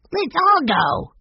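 high, loud and drawn out; eager cry. Acted. Benny begging to join the trip to the hut, high eager cry. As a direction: call it out, thrilled to come along. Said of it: "cried Benny".

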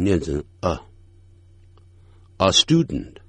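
a student with a short weak schwa. Shown as a demonstration. Teacher modelling a weak article, schwa a. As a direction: reduce a to a light uh before student.

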